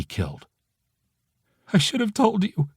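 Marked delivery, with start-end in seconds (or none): 1.7-2.7 higher, tearful confession; through tears. A narrator's reading. Friend admitting her failure, through tears. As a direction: push the words out through tears. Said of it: "she says through tears".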